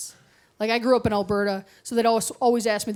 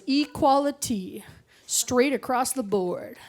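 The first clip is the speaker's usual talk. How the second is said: slow, emphatic coworker; 2 semitones higher, 3 dB quieter, slower than usual. Retold or imitated. Coworker insisting slowly.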